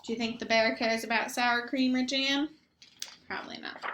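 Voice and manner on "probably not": breathy whisper; whispered aside. Real, not acted. Narrator whispering an aside to the children. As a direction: whisper it to the listener.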